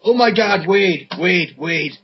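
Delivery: mock-urgent calling to a teammate; mock plea. As a wrestler showing Wade his pants, mock plea.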